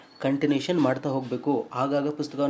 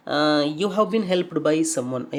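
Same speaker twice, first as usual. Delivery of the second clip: ordinary talk, nothing marked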